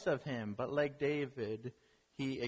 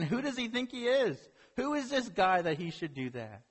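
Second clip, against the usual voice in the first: outraged; 6 semitones higher than usual. Retold or imitated. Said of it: "he's thinking".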